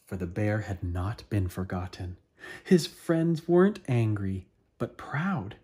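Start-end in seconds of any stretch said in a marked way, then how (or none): none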